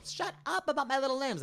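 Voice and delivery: high, exaggerated mock yell; high-pitched comic outburst imitating her; indignant friend imitation. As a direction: squeal it in mock protest as your teased friend. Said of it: "'cause then she'd go".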